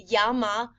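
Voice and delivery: llama with the double L said as a Y; double L demo. Shown as a demonstration. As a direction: say llama as "yama", Spanish style. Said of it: "A single L sounds different than a double L".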